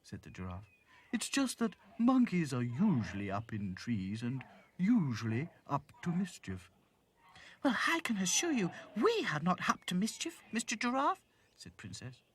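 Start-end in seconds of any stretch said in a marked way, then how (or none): 1.1-6.7 measured creature voice, slightly raised; explaining giraffe. Acted. 7.6-11.2 high, haughty doll voice; Princess objecting. Acted.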